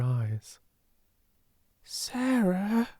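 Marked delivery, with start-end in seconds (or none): 1.8-2.9 high, tentative call; timid, awe-stricken. A narrator's reading. Ermengarde to her crying friend, timid and awe-stricken. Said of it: "she said in a timid, almost awe-stricken voice".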